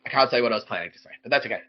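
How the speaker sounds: usual speaking voice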